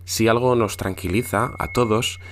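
hushed campfire horror narration; spooky storytelling. Acted. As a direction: tell it like a scary bedtime tale. Tells Santa as a horror tale.

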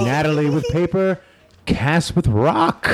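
mock match announcer building suspense; play-by-play commentator. Commentator reveals the winning throw, suspenseful play-by-play.

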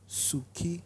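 ordinary talk, nothing marked

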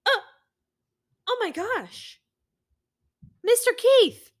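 high excited mom voice; quoting mom. Retold or imitated. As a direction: call out like a surprised mom. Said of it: "next quote is".

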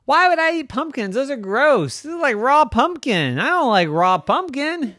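high-pitched, loud indignation; disgusted protest. Acted. Brother defending himself to Rose, disgusted.

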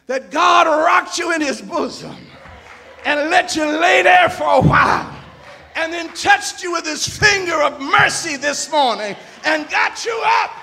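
ordinary delivery

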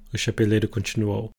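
plain reading voice

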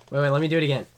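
usual speaking voice

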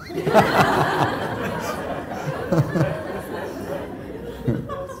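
soft, breathy run of laughter; long laugh. Real, not acted. Speaker amused by his own son's story, long soft laugh. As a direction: laugh quietly and at length.

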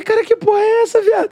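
high, startled outburst; surprised exclaim. Retold or imitated. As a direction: blurt it out in disbelief. (exclamação espantada)

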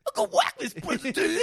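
squeaky cartoon delivery of the line; mimicking a bad actor's odd line reading. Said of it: "just talk in your normal voice".

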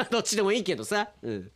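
usual speaking voice

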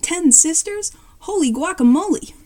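stunned, rising outburst; shocked. Acted. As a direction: exclaim it high in astonishment.

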